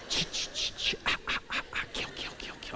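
whispered rhythmic "ch ch, ah ah" effect; imitated horror-movie sound. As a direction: mimic the slasher film's eerie whispered echo. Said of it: "Just like the movie, she just goes".

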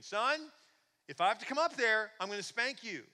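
loud, high scolding dad; acted parent at the end of patience; threatening yell. Father warning his son from downstairs, loud and threatening.

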